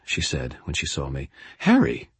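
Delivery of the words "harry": high-pitched startled name call; shocked. A narrator's reading. Friend seeing the battered wizard at her door, shocked.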